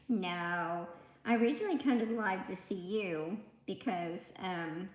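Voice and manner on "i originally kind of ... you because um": normal talking voice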